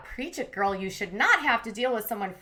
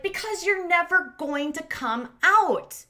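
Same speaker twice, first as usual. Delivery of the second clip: slow, higher imitation of exasperated accusing; emphatic accusation; 7 semitones higher, 4 dB louder than usual. Retelling argument about coming out to parents, emphatic accusation. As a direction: hammer each word at a partner.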